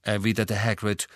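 plain reading voice

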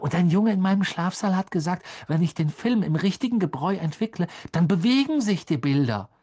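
put-on high-pitched, gushing young admirer; excited fanboy chatter; eager young fan. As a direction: gush it all out in a high voice.

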